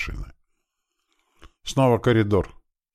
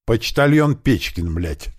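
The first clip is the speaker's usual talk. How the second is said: higher, slow exasperated retort; fed up, stretch the sarcastic answer out; getting worked up; 6 semitones higher, 4 dB louder, slower than usual. A narrator's reading. Man answering through a locked door, getting worked up. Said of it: "начал заводиться Кирпич".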